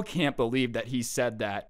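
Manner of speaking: ordinary talk, nothing marked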